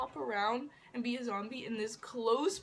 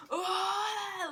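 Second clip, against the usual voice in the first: high-pitched imitated scream; mock scream; 8 semitones higher, 4 dB louder than usual. Everyone screams like zombies at the audition, imitated high scream. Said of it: "like, screams".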